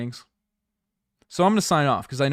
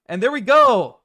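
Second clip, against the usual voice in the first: much higher and louder cheer; sudden excited outburst; 13 semitones higher, 13 dB louder than usual. Pretend. Streamer fakes signing off to get news, excited outburst. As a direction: burst out in triumph. Sudden cry while faking sign-off.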